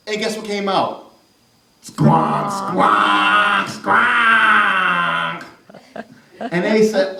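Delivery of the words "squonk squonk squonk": sax squonk. Acted. Sax sound imitated.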